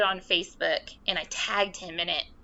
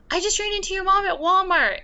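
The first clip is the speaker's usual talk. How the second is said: much higher, louder and faster friend voice; imitating gossipy friend; 12 semitones higher, 8 dB louder, faster than usual. Friend texting about meeting mom, squealed higher and louder.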